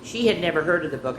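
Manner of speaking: ordinary delivery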